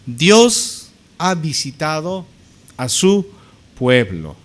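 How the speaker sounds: "Dios ha visitado" drawn out, pauses before "su pueblo"; slow proclamation. Acted. Preacher voicing the crowd glorifying God, slowly proclaiming. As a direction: declaim it slowly in separate, weighty pieces. Declaimed crowd quote, then restated plainly.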